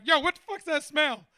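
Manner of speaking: high, loud, baffled outburst; put-off client. Retold or imitated. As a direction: blurt it out like a suspicious tough guy. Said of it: "'cause he's like".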